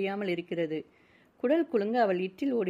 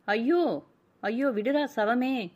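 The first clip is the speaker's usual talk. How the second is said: higher, louder cry of alarm, drawn out; distressed protest; 5 semitones higher, 4 dB louder, slower than usual. A narrator's reading. Woman struggling against her attacker, distressed cry. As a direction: cry out to be let go.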